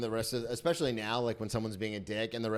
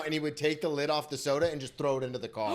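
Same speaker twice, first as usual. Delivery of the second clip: ordinary talk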